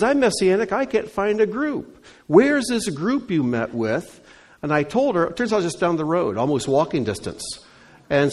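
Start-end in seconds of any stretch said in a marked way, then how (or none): 0.0-4.2 high-pitched, louder voice of an eager lady. Retold or imitated. Lady emailing the pastor from England, high and eager. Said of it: "She says".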